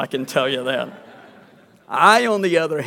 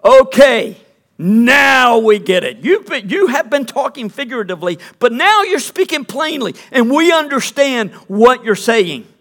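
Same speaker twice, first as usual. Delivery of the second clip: louder and higher, a proud we-get-it; disciples' eager claim; 6 semitones higher, 8 dB louder than usual. Acted. Disciples telling Jesus they finally understand, loud and eager. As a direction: blurt it out as if you finally understand. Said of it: "the disciples said".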